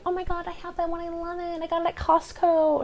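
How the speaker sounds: very high, gushing imitation; mock the excited plant buyers; gushing fan voice. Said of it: "so many people were like".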